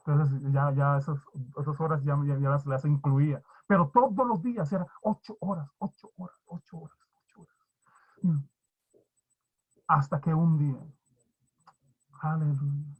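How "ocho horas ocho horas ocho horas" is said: fading repetition. Shown as a demonstration. Preacher repeating his prayer hours, fading into silence. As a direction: repeat it, each time much softer until it vanishes.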